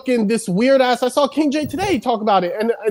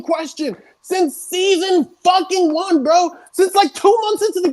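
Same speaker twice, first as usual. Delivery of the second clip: high, slow, exasperated complaint; fed-up player ranting at the developers; 6 semitones higher than usual. Real, not acted. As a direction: spit it out like you've asked a hundred times. Ranting about an unfixed game mechanic.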